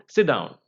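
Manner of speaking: DOWN falls steeply in pitch; falling tone. Shown as a demonstration. Teacher giving an imperative example, falling tone. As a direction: drop DOWN like an order. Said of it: "a falling tone".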